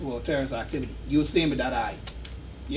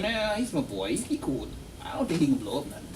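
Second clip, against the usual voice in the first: quiet inner voice; 3 semitones lower, 7 dB quieter than usual. Retold or imitated. Imagined bank teller's inner voice.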